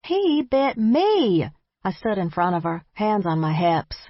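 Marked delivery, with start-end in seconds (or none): 0.0-1.5 high, slow indignant whine; whiny complaint. Acted. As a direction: complain like a wronged child. Complaining to grandmother.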